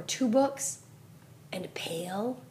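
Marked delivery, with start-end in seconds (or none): 1.5-2.4 whispering. Real, not acted. Whispered hint to child.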